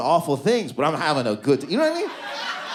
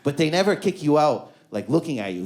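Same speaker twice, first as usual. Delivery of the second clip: ordinary talk, nothing marked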